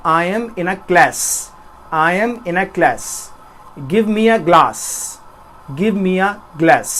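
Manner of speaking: slow American accent, flat a as in cat. Shown as a demonstration. Teacher demonstrating the American accent to students, slow and careful. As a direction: say class and glass the American way. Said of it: "अगर इसी बात को मैं American accent में बोलना चाहूँगा".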